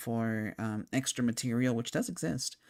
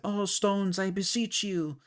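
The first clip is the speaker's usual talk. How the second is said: dramatic plea; 8 semitones higher than usual. Retold or imitated. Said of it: "Ramza's like".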